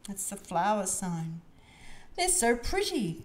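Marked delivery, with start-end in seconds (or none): 2.1-3.2 high, admiring storybook exclamation; lightly gasped admiration, pitched high; gasped delight. A narrator's reading. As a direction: gush like a delighted little one. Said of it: "gasped Peppa".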